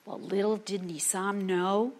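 ordinary delivery

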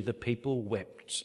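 normal talking voice

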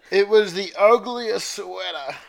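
high, loud, slow declaration; dramatic proclamation. Acted. Reader reveals what the monstrosity was, dramatic proclamation. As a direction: announce it with drawn-out mock horror.

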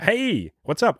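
high, excited greeting; walk up delighted, pitch lifted; really happy. Shown as a demonstration. Dating coach walking up to girls, really happy and excited. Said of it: "you're really happy and you're like".

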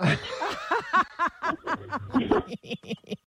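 high-pitched, long laugh; big laugh. Real, not acted. Host laughing at a caller's husband joke, high laughter.

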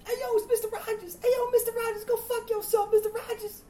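put-on very high, loud jeering teen voice; mocking teen. Twelfth graders taunting the substitute about his outfit. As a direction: jeer at the new sub like a punk kid.

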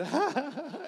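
high, bright burst of laughter, mimicking her; imitating her laugh. Imitating how she laughed at his proposal, high laugh. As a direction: let out her amused laugh. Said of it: "She just straight laughed".

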